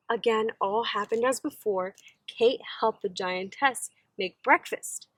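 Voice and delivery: ordinary delivery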